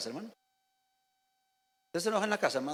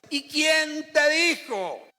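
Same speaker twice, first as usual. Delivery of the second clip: slow, high, loud challenge; preacher shouting at the congregation; challenging shout; 8 semitones higher, 5 dB louder, much slower than usual. Real, not acted. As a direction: call out the quitters, drawn out. Preacher confronts people quitting church.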